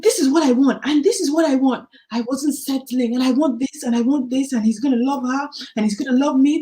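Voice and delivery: reliving a bold, animated stand on the phone; emphatic demands. Retold or imitated. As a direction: lay out every demand, fired up. Laying down demands to him.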